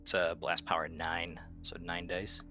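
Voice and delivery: usual speaking voice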